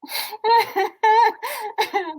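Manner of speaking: loud, high burst of laughter; real belly laugh at the joke. Real, not acted. Listener cracks up at joke.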